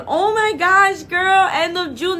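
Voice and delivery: high, loud squeal of delight; excited. Real, not acted. Host learning a viewer's surgery date, delighted.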